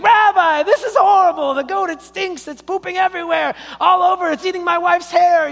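loud, very high wailing complaint; hammed-up, appalled shouting at the rabbi; exasperated outcry. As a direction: shout it like a man at his wits' end.

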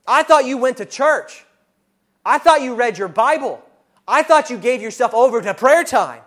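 high, loud scornful accusations; the world screaming contempt at a failing believer; accuser yelling. Acted. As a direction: pile on the shame like a mocking accuser. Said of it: "is screaming at your face".

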